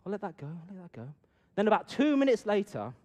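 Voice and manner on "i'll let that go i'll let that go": softer, fed-up inner voice; a hushed, irritated private thought; inner grumble. Retold or imitated. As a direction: mutter it to yourself, annoyed. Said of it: "So I'm thinking".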